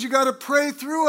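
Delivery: loud, high urgent call; high, loud and pressing; shouted advice. Retold or imitated. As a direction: call it out like a revival helper. Third exhorter at altar.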